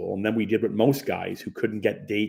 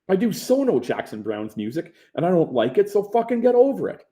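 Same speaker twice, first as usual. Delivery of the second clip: heated argument; 9 semitones higher than usual. Retold or imitated. Argument escalating.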